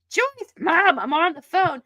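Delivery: high, loud whining complaint; annoyed teen. Retold or imitated. Teen in Just Friends scolds mom, high loud whine. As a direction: push mom off the line. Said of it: "the mom is like".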